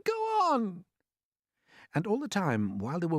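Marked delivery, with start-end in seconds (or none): 0.1-0.8 frantic cry. A narrator's reading. Creatures urging, frantic.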